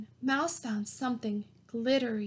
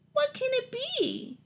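much higher, tiny wondering; squeaky mouse voice; 13 semitones higher than usual. A narrator's reading. Mouse in the dirt, squeaky and curious.

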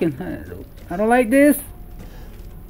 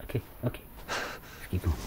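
whisper; 12 semitones lower, 19 dB quieter than usual. Real, not acted. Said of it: "I shouldn't be yelling in this".

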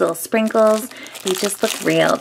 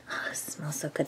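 whispering; 6 semitones lower, 18 dB quieter than usual. Real, not acted. Smelling squishy, whispering.

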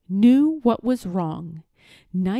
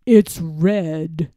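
louder, lower, lying lord; a lie dressed up as fact; sly villain; 5 semitones lower, 6 dB louder than usual. Acted. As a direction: lord falsely accusing Eric, sly and low.

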